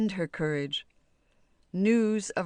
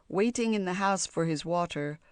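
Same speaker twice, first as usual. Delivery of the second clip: ordinary delivery, nothing marked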